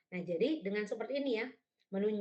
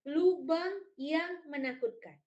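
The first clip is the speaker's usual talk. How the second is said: slow, higher, louder word-by-word reading; pointing read-aloud; 6 semitones higher, 5 dB louder, slower than usual. Shown as a demonstration. Teacher showing point-along reading to storytellers, slow read-aloud. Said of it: "membacakannya dengan menunjuk".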